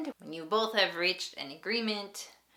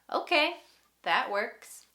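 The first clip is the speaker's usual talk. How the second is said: bright agreement; 7 semitones higher, 5 dB louder than usual. Shown as a demonstration. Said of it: "you can reply with".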